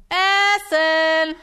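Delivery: loud high call of Essen, drawn out. Retold or imitated. Mother calls playing child to dinner, loud drawn-out call. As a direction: call the child across the house.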